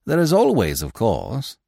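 ordinary delivery

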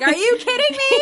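high, loud, laughing protest; cracking up while protesting; laughing disbelief. Retold or imitated. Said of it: "I'm just like".

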